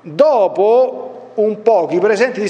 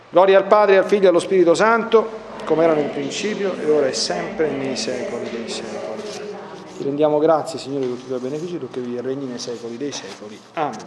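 a liturgical prayer, fading softer at the end; spoken prayer; 3 dB quieter, faster than usual. Acted. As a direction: recite the doxology like a closing prayer. Closing prayer. (preghiera liturgica recitata, sempre più piano)

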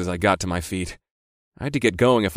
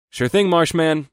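blithe, higher-pitched sign-off; light, carefree cheek; 7 semitones higher, 4 dB louder than usual. A narrator's reading. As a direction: agree breezily, voice pitched up. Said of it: "I said blithely".

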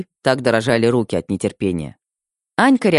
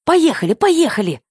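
high, urgent hurry-up cry; rushing the driver to go; urgent excited bride. Acted. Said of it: "замахала руками невеста".

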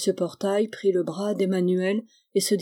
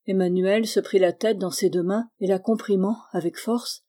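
plain reading voice

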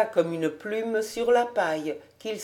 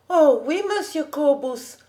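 put-on higher, eager little girl voice; young girl; 7 semitones higher, 3 dB louder than usual. A narrator's reading. Young girl replying to the man who asked, high and eager. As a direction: answer as a delighted young girl, pitched up. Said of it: "faisait la petite".

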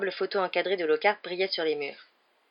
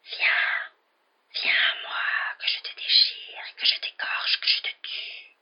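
icy, venomous slow whisper; cold brittle frosty whisper; much slower than usual. A narrator's reading. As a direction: breathe it like poison. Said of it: "Une voix glacée comme un venin". (chuchotement glacial et venimeux)

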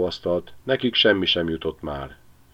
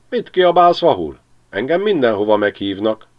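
cheeky magpie, much higher and louder; taunting magpie; 4 semitones higher, 7 dB louder than usual. Acted. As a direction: tease the dog from the rooftop. (a szarka gúnyosan csúfolja a kutyát)

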